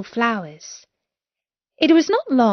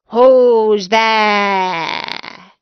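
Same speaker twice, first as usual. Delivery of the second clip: louder wolf pretending to be grandmother; the wolf in bed asking who knocks, louder; a big-voiced wolf, louder; 6 dB louder than usual. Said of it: "the big voice of the wolf".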